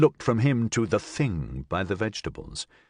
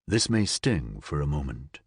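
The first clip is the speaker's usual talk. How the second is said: gentle warning; 6 semitones lower than usual. Acted. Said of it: "he warned".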